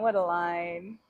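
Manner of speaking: ordinary talk, nothing marked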